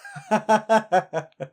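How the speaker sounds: high, loud burst of laughter; hearty laugh. Acted. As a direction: laugh out loud at a crude joke. Laughs at the crude joke.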